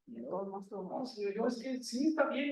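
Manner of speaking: a hushed, shrinking no to God; pleading refusal. Acted.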